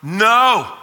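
high, emphatic refusal; mock-panicked soldier's protest. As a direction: cry out like a balking soldier, pitched well up. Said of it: "he looks up and he's like".

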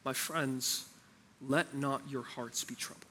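soft, slow, gentle voice of Jesus comforting; tender. Retold or imitated. Jesus comforting his worried disciples, soft and tender. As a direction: lay it down tenderly, like calming frightened friends. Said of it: "speaks with a tender heart".

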